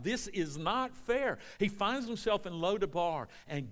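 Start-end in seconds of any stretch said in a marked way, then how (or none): 0.0-1.4 slow, high cry of protest; Job crying out. Acted. Job to God about unfair suffering, crying out. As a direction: drag out each word in aggrieved appeal. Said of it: "he could have cried out".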